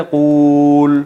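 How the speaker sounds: قول held on one long, level qooooool; dragged out. Shown as a demonstration. Teacher mocks how long saying it takes, absurdly stretched vowel. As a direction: stretch the oo in قول until time runs out. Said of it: "اتنا لمبا میں نے کھینچ دیا".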